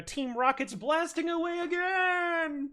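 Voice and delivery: high, loud, drawn-out cartoon wail; Team Rocket. Villains kicked out of the ether, drawn-out cartoon shout. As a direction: stretch it out as you fly away. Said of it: "like he was getting kicked out of the ether".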